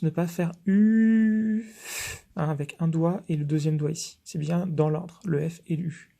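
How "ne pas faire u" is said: "U" stretched long, high and louder; drawn-out vowel demo. Shown as a demonstration. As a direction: hold "U" out slowly like a piano key. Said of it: "Attention, point de vigilance".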